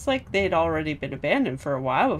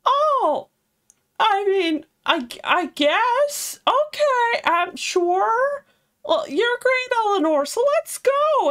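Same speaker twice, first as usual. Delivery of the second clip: put-on much higher, hesitant boyish squeak; shy high Laphicet; 4 dB louder, slower than usual. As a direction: stammer it out like a flustered kid. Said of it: "Laphicet's like".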